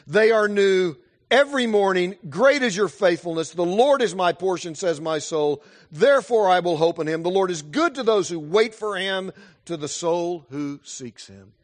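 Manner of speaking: loud, high, ringing proclamation; fervent scripture reading. Acted. Preacher crying out God's faithfulness to the church, loud and ringing. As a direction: build the promise into a triumphant cry. Preacher reads Lamentations with force.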